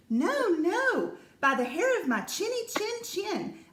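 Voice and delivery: a high, squeaky little pig who won't budge; little pig refusing. A narrator's reading. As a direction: squeak out a stubborn no. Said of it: "The little pig grunted and answered".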